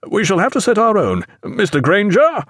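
high-pitched captain's hail; calling an officer. A narrator's reading. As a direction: call out to Mister Granger. Captain turns to call officer.

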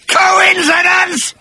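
a high, loud, drawn-out slow scream of "Coincidence!"; screaming creature. Acted. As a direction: scream it in outraged disbelief. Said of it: "screamed the creature".

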